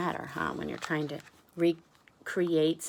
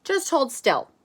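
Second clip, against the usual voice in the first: higher, louder command; Max instructing; 8 semitones higher, 8 dB louder than usual. Acted. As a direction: tell him to hold still, raised voice. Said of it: "And Max says".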